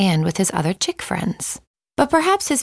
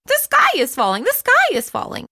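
high, then even higher panicked squeal; frantic chick, repeated. Acted. Chicken Little repeating to Henny Penny, a panicked squeal. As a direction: insist desperately, climbing higher. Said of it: "Chicken Little repeated".

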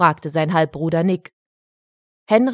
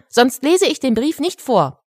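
put-on higher-pitched boy's warning; demanding; 7 semitones higher, 4 dB louder than usual. A narrator's reading. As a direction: lay down a condition to noisy listeners. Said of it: "verlangte Henrik".

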